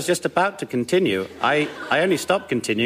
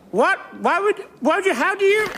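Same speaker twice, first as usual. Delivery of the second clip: high, flustered splutter. Acted. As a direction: caught out and spluttering. Caught out, flustered.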